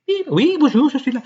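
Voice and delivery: high, loud fake phone greeting; hammed-up high phone voice; mock phone answer. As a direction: pick up like an eager call-centre voice.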